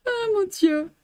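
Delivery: usual speaking voice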